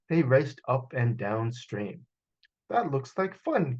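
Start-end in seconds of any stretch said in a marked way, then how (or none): 2.7-3.8 higher, lightly voiced clucking rooster; a chatty rooster eyeing the boats. Rooster remarks on the race, lightly voiced higher. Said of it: "clucked the rooster".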